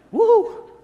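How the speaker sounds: high loud whoop like a siren; mock siren whoop. Preacher imitating the police siren with a loud whoop. Police lights appear behind him.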